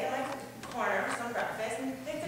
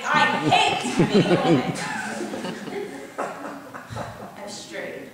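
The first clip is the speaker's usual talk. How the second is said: laughing; 6 dB louder, much slower than usual. Real, not acted. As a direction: laugh through the words. Laughing at own joke.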